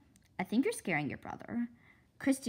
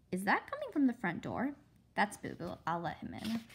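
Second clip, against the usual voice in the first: ordinary delivery, nothing marked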